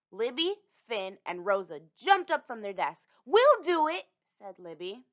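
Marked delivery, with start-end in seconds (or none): 3.2-4.0 eager volunteering. Acted.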